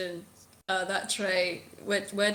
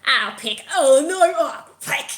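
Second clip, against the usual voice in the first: loud, higher cries of alarm; overheard dismay; 3 semitones higher, 9 dB louder than usual. Acted. Game master voicing creatures caught by their net, loud dismay. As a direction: yelp in comic dismay. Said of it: "and you just hear a".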